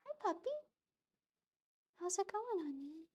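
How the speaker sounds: high sweet pet voice; talking to a puppy. Real, not acted. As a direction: coo at the dog. Greeting her dog.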